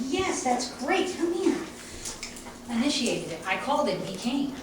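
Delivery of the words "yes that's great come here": high, slow, bright dog praise; happy praise. Shown as a demonstration. Trainer calling her dog with much higher, slow praise. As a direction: lift the voice and reward him. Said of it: "turn it on and turn it off and go".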